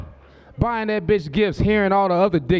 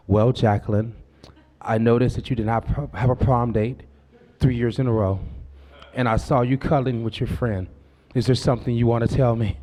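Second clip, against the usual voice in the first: mock gentle reasoning; 8 semitones lower than usual. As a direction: reason gently like a careful father. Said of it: "you gotta reason with 'em".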